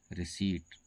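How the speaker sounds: रिसीट with silent P, long EE; correct pronunciation. Shown as a demonstration. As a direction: model रिसीट clearly without any P sound. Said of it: "इसका सही उच्चारण है". (रिसीट का सही उच्चारण, प silent)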